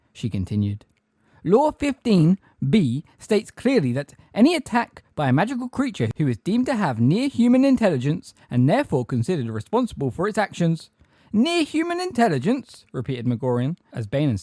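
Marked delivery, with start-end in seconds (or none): 1.4-10.9 officious recital. A narrator's reading. Umbridge quoting law to centaurs, officious high voice. 11.3-12.9 very high, loud, incredulous question; affronted echo. A narrator's reading. Magorian repeating Umbridge's phrase, affronted disbelief.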